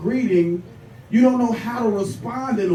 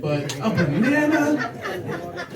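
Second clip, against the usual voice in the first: high, drawn-out disbelief; incredulous; 8 semitones higher, much slower than usual. Real, not acted. Comedian scoffing at the banana claim, incredulous. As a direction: stretch it upward into a stunned question.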